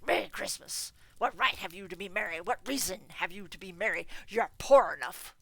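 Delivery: loud, contemptuous scoffing; scornful old miser. Acted. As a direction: sneer at his cheer. Scrooge scoffs at nephew.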